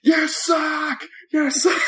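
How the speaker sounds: high-pitched mock kid scream; imitated nine-year-old yelling at a wrestler. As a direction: screech it like a heckling child, close to laughing.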